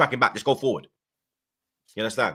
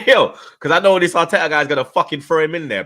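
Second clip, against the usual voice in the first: loud, raised-pitch agitated protest; exasperated outburst; 6 semitones higher, 7 dB louder than usual. Real, not acted. Host dreading the manager's team selection, exasperated outburst. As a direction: burst out high and loud, exasperated. Worked up that manager will play Saka.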